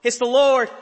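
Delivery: John shouting to the boat, full excited shout. Retold or imitated. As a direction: shout it out in joy. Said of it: "And John yells".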